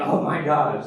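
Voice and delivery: loud, high shocked reaction; mock alarm. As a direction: play the horrified listener. Imagines listeners' alarm at pregnancy.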